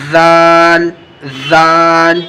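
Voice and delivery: زال with tongue tip between the teeth; letter demo. Shown as a demonstration. Quran teacher demonstrating زال. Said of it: "دونوں دانتوں کے بیچ میں".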